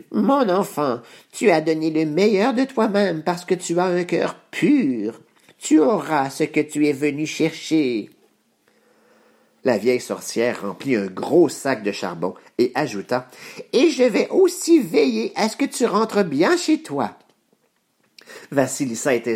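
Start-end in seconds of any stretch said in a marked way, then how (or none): 0.1-8.1 a hint of an old witch, softened; gentle approving witch, voiced higher; lightly voiced kind witch. Baba Yaga praising the girl. 13.7-17.2 high, bright witch promise; old witch voice. Acted. Baba Yaga promising safe return.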